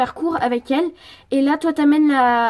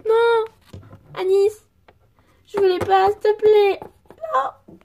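high, anguished begging; acted high wail of despair; desperate pleading; 8 semitones higher, 4 dB louder, slower than usual. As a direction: cry out and beg a friend to stay. Zoé pleads desperately with Anis.

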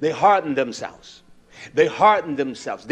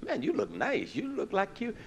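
much higher, loud gushing sweetness; butter up the boss, sugary and eager; fawning flatterer; 10 semitones higher, 5 dB louder than usual. Pretend. Fawning over manager who walks in.

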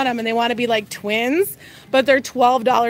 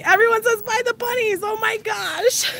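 much higher, delighted squeal; thrilled by the chat; real giddy high voice; giddy excitement; 10 semitones higher than usual. Real, not acted. Reads flood of chat requests.

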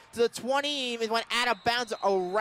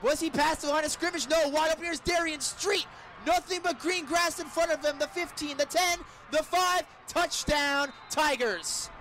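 higher and faster as the runner breaks free; building excitement; 6 semitones higher than usual. Real, not acted. Radio announcer following a sixty-eight-yard run, rising excitement. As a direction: ride the run all the way to the end zone. Long touchdown run called live.

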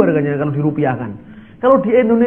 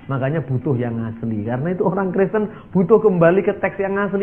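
ordinary delivery

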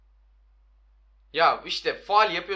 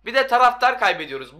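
loud, high-pitched exasperation; fed-up gamer raising his voice; angry outburst; 7 semitones higher, 10 dB louder than usual. Real, not acted. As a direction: burst out in real annoyance. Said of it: "çok sinirlendim yani".

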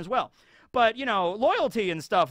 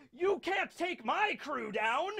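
high, soft, drawn-out taunt; oblivious defiant captain; 9 semitones higher, 5 dB quieter, slower than usual. Acted. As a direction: slowly taunt the enemy in a high voice. Rox taunts, not seeing crew gone.

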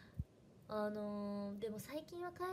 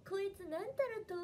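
mock classmates' teasing, pitched up; mocking imitation; 8 semitones higher than usual. Classmates make fun of her Hakata dialect, mocking. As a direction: tease by parroting the dialect, high. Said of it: "めっちゃバカにされて".

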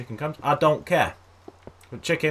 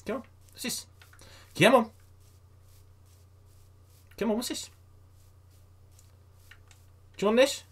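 coaxing pet voice; 12 semitones higher than usual. Real, not acted. Coaxing a chicken to follow.

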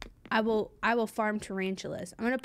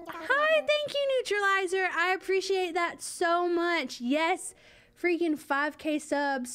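much higher, gushing thank-you; excited thanks; 7 semitones higher, slower than usual. Real, not acted. Streamer thanking a donor for subs, excited squeal. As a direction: squeal your gratitude at the donor.

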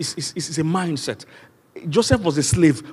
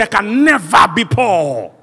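loud, high, drawn-out declaration; bold declaration; 6 semitones higher, 6 dB louder, much slower than usual. Shown as a demonstration. Preacher has the congregation declare they're never poor, bold declaration. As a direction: declare it boldly, stretching the words. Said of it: "No, say it with boldness".

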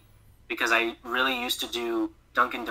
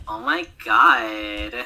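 slow, louder drawn-out gasp of delight; mock awe; 2 semitones lower, 5 dB louder, much slower than usual. Retelling seeing the pumpkin commercial, slow mock awe. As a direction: stretch every word in exaggerated amazement.

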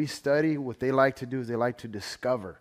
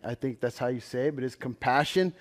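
ordinary talk

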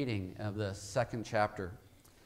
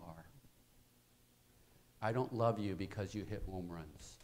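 tender father; 5 semitones lower, 6 dB quieter than usual. Retold or imitated. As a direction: speak gently to a hurting child. Father tenderly reassures son.

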